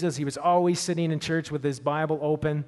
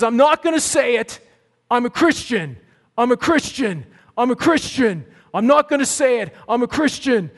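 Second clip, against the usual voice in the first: loud, high insistent shout; yelling; 6 semitones higher, 6 dB louder than usual. Retold or imitated. Tim yelling back at the bus kids, loud defiant shout. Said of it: "he yells to the back of the bus".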